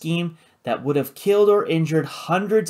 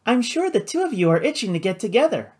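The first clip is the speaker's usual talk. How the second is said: high, grandly announcing feminine voice; playful hostess; 8 semitones higher, faster than usual. A narrator's reading. Flora introducing her brother to Karina, playfully grand. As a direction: introduce someone with playful ceremony, pitched high.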